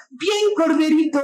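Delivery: high, drawn-out motherly cheer; mom's mock cheer. Mom cheering her grown son at the pickup game, high mock yell. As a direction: call it out slowly like an embarrassing mother. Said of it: "tu mamá te grita".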